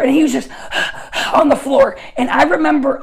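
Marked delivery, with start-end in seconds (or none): 0.5-1.3 soft, breath-only mock pants; imitated dog panting. Preacher shows the dog on the floor, imitated panting. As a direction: mimic an exhausted dog's panting. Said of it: "he's hot, and he's panting".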